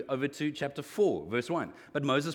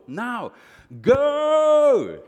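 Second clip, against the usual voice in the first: commanding shout; 10 semitones higher, 14 dB louder than usual. Acted. God commanding Moses to go.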